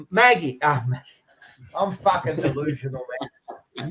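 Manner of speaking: ordinary delivery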